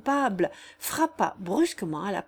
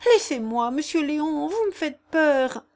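higher and louder, slowing in fear; frightened voice; 7 semitones higher, 5 dB louder than usual. Acted. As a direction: plead shakily to be let go. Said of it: "la voix altérée de Jeanne".

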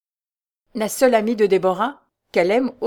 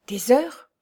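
raised, sharply rising question; alarmed question; 5 semitones higher than usual. A narrator's reading. Young man learns only hours remain, alarmed. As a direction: echo it in disbelief. Raoul reacts to dreadful news.

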